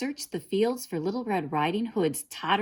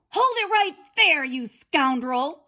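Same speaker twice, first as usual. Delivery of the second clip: high, loud angry farmer; farmer's shout; 9 semitones higher, 7 dB louder than usual. A narrator's reading. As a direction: yell at the wolf to freeze. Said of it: "the farmer shouted".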